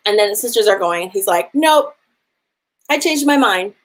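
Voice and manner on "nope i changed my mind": a petty chief gloating as he changes his mind; mock spiteful refusal. Angry chief refuses sisters.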